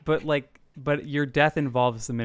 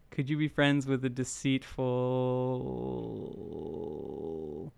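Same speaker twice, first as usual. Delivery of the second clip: drawn-out stalling; 3 semitones lower, 7 dB quieter, much slower than usual. Real, not acted. Host reading the prompt while it respins, last word held long. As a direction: stretch the end of "deceitful" on and on.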